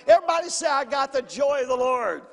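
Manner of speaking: loud, high call to the crowd; preaching at full force. Acted. Pastor leading the congregation in a loud call. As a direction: lead the church in a shout.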